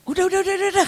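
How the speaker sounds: high, quick brush-off; a hint of a flustered husband; dismissive husband. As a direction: wave her off with repeated words.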